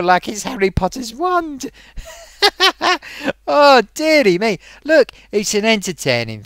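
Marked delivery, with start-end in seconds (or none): none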